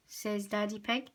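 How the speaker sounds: ordinary delivery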